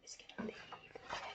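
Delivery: put-on hushed aside to the children; whisper. A narrator's reading. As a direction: whisper it like a secret. Aside to listeners about George.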